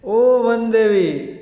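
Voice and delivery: loud, high, slow plea; loud cry. Acted. Bubble tree begs the forest goddess for rescue, crying loudly. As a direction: call out for help, drawn out. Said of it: "It cried loudly".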